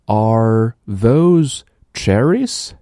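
very slow rising question for a listening test; slow dictation question. Shown as a demonstration. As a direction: read it slowly so pupils can pick out "cherries". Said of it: "我来读，然后你告诉我". (极慢的听力示范问句)

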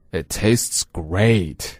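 It tastes great drawn out very slowly; slow, deliberate teacher reading; very slow model. Shown as a demonstration. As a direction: stretch every word for learners. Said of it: "再来一遍。".

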